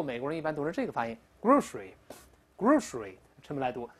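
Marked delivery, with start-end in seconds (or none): 1.4-3.1 grocery squeezed to two syllables, GROHSH-ree; fast-speech pronunciation. Shown as a demonstration. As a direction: say "grocery" with s turned into sh. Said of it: "我们读快了之后".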